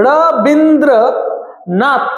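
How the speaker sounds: "Rabindranath" slowly broken into ra, bin, dro, nath; syllable by syllable. Shown as a demonstration. Teacher counting syllables in a name, "Rabindranath" slow and split. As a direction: separate each syllable of "Rabindranath". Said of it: "তিনটা syllable হলো".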